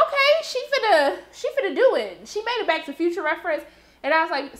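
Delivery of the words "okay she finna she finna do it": excited disbelief. Retold or imitated. Reacting as plane takes off.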